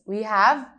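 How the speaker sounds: normal talking voice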